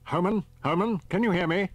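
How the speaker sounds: brisk countdown orders; captain Peters. A narrator's reading. Peters calls on radio.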